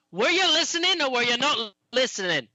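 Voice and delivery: high-pitched scolding character voice; small creature telling off a big one; indignant halfling. Acted. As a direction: pipe up, demanding an answer.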